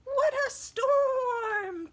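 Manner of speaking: very high, loud bird cry; twittering wren. Acted. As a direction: trill it like a little bird. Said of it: "twitters wren".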